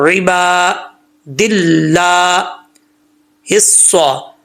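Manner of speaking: slow रिबा दिल्ला with throaty ain and held vowels; careful tajweed. Shown as a demonstration. Teacher shows the length, measured recitation. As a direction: stretch each long vowel in रिबा दिल्ला हिस्सा. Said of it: "अलिफ लंबा करेंगे".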